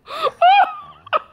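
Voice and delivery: very high, loud cackle; big laugh. Real, not acted. Mom cracks up at son's story, laughing. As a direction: burst out in helpless high laughter.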